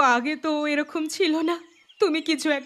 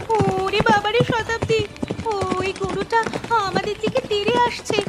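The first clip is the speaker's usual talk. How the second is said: panicked shout; 6 semitones higher than usual. Acted. Girl warning Shatabdi the cow is charging, panicked shouting. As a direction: yell at her friend to flee the cow.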